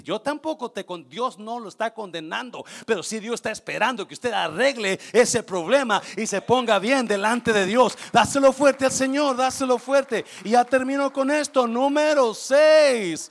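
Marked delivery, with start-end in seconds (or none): 2.9-13.3 much louder, higher fervent preaching; full-force preaching. Real, not acted. Preacher urges the congregation to make things right, fervent. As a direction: shout it to the congregation.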